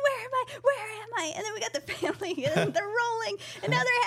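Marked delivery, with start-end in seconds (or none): 0.0-1.3 lost emoji voice. Acted.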